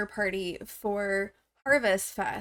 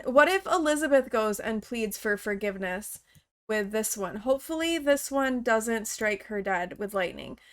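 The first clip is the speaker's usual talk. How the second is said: normal talking voice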